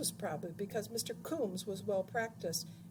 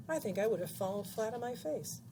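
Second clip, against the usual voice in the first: plain reading voice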